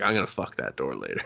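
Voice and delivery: low, hushed leering aside; quiet leer. Retold or imitated. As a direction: mutter it under your breath. Lustful aside, much quieter.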